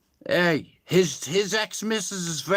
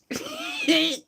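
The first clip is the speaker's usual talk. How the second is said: shrieking high laugh; shrill cackle at his own joke; 3 dB quieter than usual. Real, not acted.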